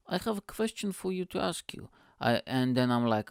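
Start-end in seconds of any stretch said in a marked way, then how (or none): 0.1-1.9 put-on high, soft Bulgarian-accented father; imitated Bulgarian dad; dad's Bulgarian accent. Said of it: "I couldn't do the accent".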